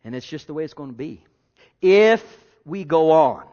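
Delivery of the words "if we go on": "If we go on" called out loud and drawn out; loud and slow. Real, not acted. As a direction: preach it big and slow, reaching the back row. Preacher builds then drops.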